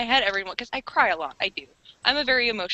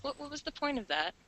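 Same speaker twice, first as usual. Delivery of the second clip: quiet self-scold; 3 semitones higher, 13 dB quieter than usual. Retold or imitated. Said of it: "I'm just like".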